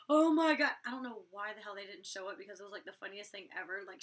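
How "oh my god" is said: mock shock. Girls react to her insult.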